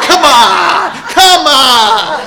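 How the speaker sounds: high, loud, laughing come on; mock exasperation through laughter; laughing in disbelief at the song; laughing protest. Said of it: "I'm like".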